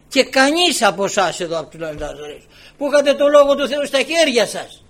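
loud, high pulpit rebuke; reproachful preaching. Real, not acted. Preacher confronts the Nazarenes who had God's word, reproachful. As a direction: rebuke the whole congregation.

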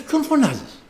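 high-pitched questioning rebuke, falling at the end; voice of the Lord. Retold or imitated. The Lord challenges the father's cry for help, retold high rebuke. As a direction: pointedly ask who is being called, voiced high. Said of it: "Και μου λέει ο Κύριος".

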